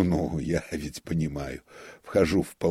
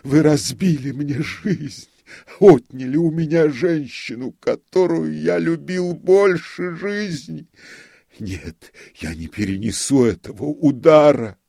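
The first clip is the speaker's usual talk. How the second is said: anguished lament; 12 semitones higher, 11 dB louder than usual. Acted. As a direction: grieve loudly over a broken life. Notary laments the lost wife. (высокий, громкий, медленный плач отчаяния)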